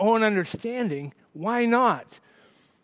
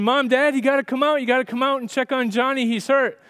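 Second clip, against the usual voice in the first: put-on panicked kid; 6 semitones higher than usual. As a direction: yell for mom and dad in a panic. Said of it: "he's hysterical".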